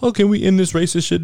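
high mocking voice of the ESPN crowd; mocking whiny quote. Ranting podcaster mocks ESPN pundits, high mocking voice. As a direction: sneer it like a fake plea. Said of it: "come out on social media from E S P N".

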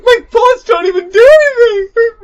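high, loud, whiny cry of despair; mock panicked wail. Player retelling three bosses attacking, mock panicked wail. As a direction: wail it like a hammed-up losing player.

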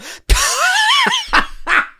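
very high, exaggerated fake laugh; mock dad laugh. Imitating a friend laughing at a dinner joke, high and exaggerated. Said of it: "someone says a joke and you're all".

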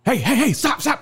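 high, rapid-fire stop calls; urgent calling out. Retold or imitated. Guy calling after a shoplifter leaving the store, urgent calling out. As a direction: imitate someone urgently halting a thief. Acts out stopping a fleeing shoplifter.

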